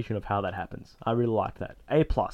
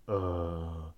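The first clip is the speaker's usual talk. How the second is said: put-on drunk groan; 6 semitones lower, 9 dB quieter, much slower than usual. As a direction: groan it low and slow like a drunk on the couch. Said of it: "he's really drunk".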